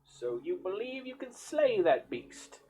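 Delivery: a hint of a kindly village healer warning the hero; healer woman's voice.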